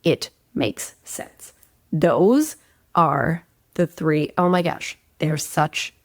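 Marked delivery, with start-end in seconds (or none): 0.0-1.5 whispered, slow and soft, leaning on "It makes sense"; slow hushed emphasis. Shown as a demonstration. Coach to listeners, hushing the key words slowly. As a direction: breathe "It makes sense" out slowly, almost unvoiced. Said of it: "It was when he said".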